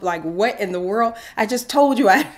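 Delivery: loud, high-pitched disbelief; exasperated. Retold or imitated. She silently reacts to mentor's repeated question, exasperated. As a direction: burst out, baffled at being asked twice.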